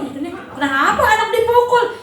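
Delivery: mock puzzled parent. Mother puzzled child hits her.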